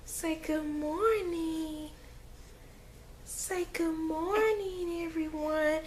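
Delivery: very high, slow, soft cooing. Real, not acted. Mom coaxing baby to greet viewers.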